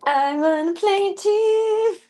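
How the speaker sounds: high, slow, far put-on kitty voice; cat's voice. Voicing her cat who wants to play, squeaky. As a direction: speak for the cat, pleading sweetly.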